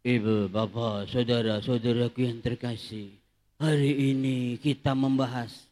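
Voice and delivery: deep solemn sermon parody; parish priest sermon voice. Retold or imitated. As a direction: mimic the old priest's pulpit drone. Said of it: "khotbahnya begini".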